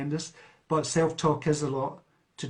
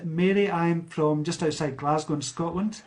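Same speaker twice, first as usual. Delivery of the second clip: usual speaking voice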